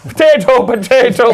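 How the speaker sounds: loud, high mock-Irish; bad Irish accent. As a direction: belt it out in a hammed-up Irish brogue. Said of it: "It's my Irish accent".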